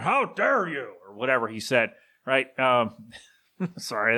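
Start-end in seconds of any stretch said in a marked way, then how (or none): 0.0-0.9 higher, mock-outraged boss voice; Vince McMahon. Boss scolding his wrestlers, hammed-up outrage in impression. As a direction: caricature a boss getting all mad. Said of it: "he was getting all mad".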